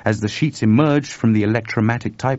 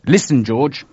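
louder, pressing call; urgent; 5 semitones higher, 6 dB louder than usual. Acted. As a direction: grab his friend's attention. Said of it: "said Chuck urgently".

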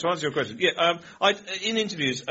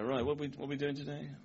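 soft, vague, mumbled teacher voice; laid-back hippie teacher; 8 dB quieter than usual. Retold or imitated. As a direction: imitate a late teacher with no plan. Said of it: "Was he a hippie".

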